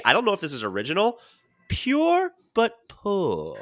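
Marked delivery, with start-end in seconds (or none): 1.7-3.6 drawn out, every word stretched; very slow, high, deliberate quote; slow quoted line. Retold or imitated. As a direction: savor the phrase slowly. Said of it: "I heard this line today".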